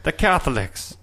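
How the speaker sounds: put-on high, slow Irish leprechaun accent, laughing; hammed-up Irish leprechaun voice. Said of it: "I'm trying to do, like, a leprechaun voice".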